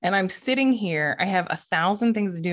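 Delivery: ordinary talk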